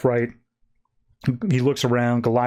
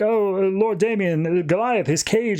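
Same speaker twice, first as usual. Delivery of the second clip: fretful servant voice; 7 semitones higher than usual. Acted. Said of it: "supposed to be a general servant".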